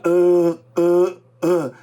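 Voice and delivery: mock stammering ah ah; imitating the hesitation sounds to avoid; hesitant filler. Shown as a demonstration. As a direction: imitate a stumbling reader. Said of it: "a gente não fica".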